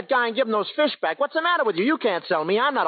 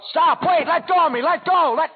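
loud, higher pleas to be let go; panicked yelling; 8 semitones higher, 4 dB louder than usual. Acted. Orville yelling as he is carried off. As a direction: yell in panic as they grab you. Dragged off by slave buyers.

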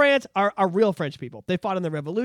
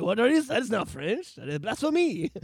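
lightly imitated Parisian French accent; mock French accent; 5 dB quieter than usual. Parisian scorning Montreal French, in a mock French accent. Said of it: "the accent".

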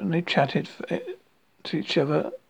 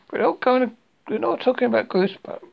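mildly cross correction; a touch of irritation, stumbling over the words; a little crossly; 5 semitones higher than usual. A narrator's reading. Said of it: "said Owl a little crossly".

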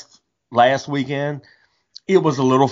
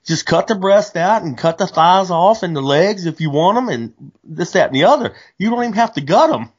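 quoting a friend; 7 semitones higher than usual. Retold or imitated. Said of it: "He said".

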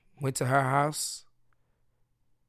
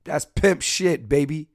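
boastful swagger; 5 semitones higher, 6 dB louder, slower than usual. Pretend. Bragging punchline.

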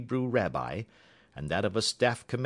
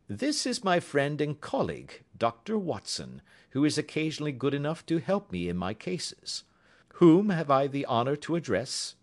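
a lightly voiced, higher, crisp detective; Holmes, cool and courteous, raised in pitch; 9 semitones higher, 5 dB louder than usual. A narrator's reading. Holmes introducing Watson to the visitor, politely.

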